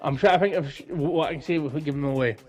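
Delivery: ordinary talk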